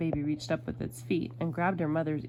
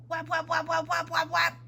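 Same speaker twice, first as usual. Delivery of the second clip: high monotone run of slapping noises; repeated high storybook whaps; flapping slaps; 10 semitones higher than usual. A narrator's reading. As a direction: slap out each word on one high note. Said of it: "flapped her father's face".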